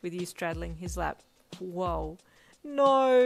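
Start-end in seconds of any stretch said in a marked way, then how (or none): 1.6-2.2 mild, marvelling exclamation; a touch of wonder; awed. A narrator's reading. Hawks with you straddling his lap, awed. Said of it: "Hawk said in awe". 2.6-3.2 high, very loud drawn-out protest; embarrassed wail. A narrator's reading. Protesting to Hawks with hands over face, wailing. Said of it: "you wailed".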